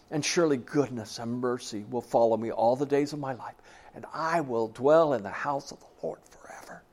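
broken, whispered final verse; tearful whisper. Retold or imitated. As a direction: fade into a tearful whisper at the end. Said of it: "he's bawling".

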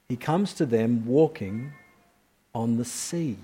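soft, drawn-out repeat, pause before on the sea; repeated slowly for emphasis. Shown as a demonstration. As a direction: say it again so it sinks in. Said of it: "We say that again".

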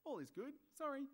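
high, sing-out carefree apology; cheerful sorry. Retold or imitated. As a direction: mimic a chirpy kid who isn't sorry at all. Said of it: "the cheerful sorry says".

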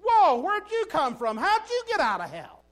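Whoa, where'd you come from, startled and loud; mock startled gasp. Angels at the meeting, pretending shock at Satan. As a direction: play a shocked angel catching sight of Satan. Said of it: "Nobody goes".

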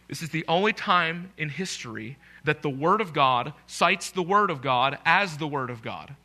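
normal talking voice

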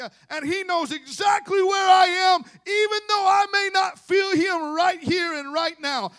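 high, shouted revival sermon; full-force preaching. Real, not acted. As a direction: shout it out with mounting conviction, very high and loud.

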